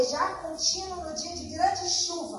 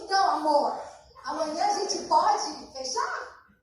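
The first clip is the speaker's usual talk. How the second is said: drawn-out, loud eager question to her husband; eager plea; 2 semitones higher, 7 dB louder, slower than usual. Retold or imitated. Wife eagerly asking husband to close the deal, loud and slow. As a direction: stretch it out, pleading with excitement.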